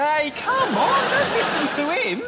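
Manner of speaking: high, slow put-on voice, pleading with the host; squeaky mock plea not to listen.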